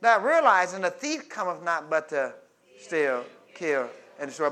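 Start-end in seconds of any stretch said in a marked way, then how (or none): none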